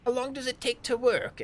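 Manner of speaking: high, loud character voice; eager high-pitched drow; drow's question. Acted. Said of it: "he goes".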